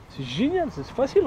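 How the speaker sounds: high sarcastic praise. Acted. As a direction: fake cheer, ironic. (ton ironique et aigu)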